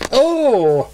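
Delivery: high bright "Oh!" of surprise; sudden delighted surprise; surprised gasp. A narrator's reading. Claire caught off guard by the book, surprised. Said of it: "said surprised".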